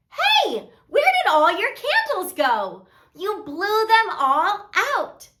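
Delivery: high-pitched, playful disbelief; pretend astonishment. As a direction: act amazed for the kids, starting on a high "hey". Pretends surprise at vanished candles.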